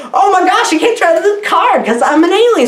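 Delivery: mock alien panic. Mock retelling of alien character.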